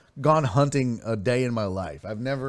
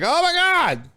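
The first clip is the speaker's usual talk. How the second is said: very high startled exclamation; retelling his shock at finding the possum, startled; 6 dB louder, slower than usual. Retold or imitated. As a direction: squeal it like the possum jumped out.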